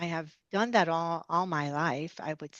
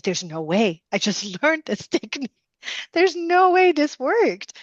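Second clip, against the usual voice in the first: stunned disbelief; 8 semitones higher, 8 dB louder than usual. Retold or imitated. Disbelief after patient enters trance.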